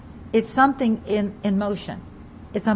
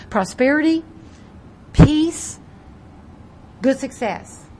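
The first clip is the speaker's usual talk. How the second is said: rising preacher exclamation; 3 semitones higher, 6 dB louder, slower than usual. Acted. Preacher promising blessings.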